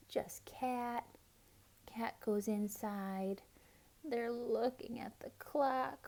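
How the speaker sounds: hushed whisper, soft and breathy. A narrator's reading. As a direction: whisper it like a secret. Hushed waiting scene.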